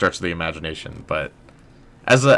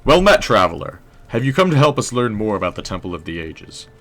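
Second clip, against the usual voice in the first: stoic historian voice, louder opening greeting; smooth, faintly British historian welcoming a visitor; stoic historian, clean take; 3 semitones higher, 5 dB louder than usual. Acted. Historian greeting a traveler at the temple, stoic and unbroken. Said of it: "Let's do another take".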